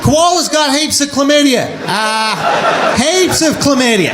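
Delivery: a mild Aussie zookeeper's cheerful fact; zookeeper's Australian accent. Retold or imitated. Australian zookeeper telling the comic a fun fact. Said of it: "and he goes".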